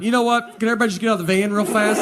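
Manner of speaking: hurried claustrophobic plea; rushed panic. Retold or imitated. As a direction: blurt it out before the panic wins. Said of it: "you just go".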